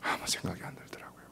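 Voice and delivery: soft unvoiced recollection; hushed whisper. Real, not acted. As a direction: remember it softly. Tender memory, whispered. (속삭이듯 조용히)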